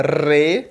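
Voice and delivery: "re" with a long trilled R; drawn-out tongue trill on "re"; Faustão's rolled R. Shown as a demonstration. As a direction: roll the R of "re" hard and long. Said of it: "fazer que nem o Faustão".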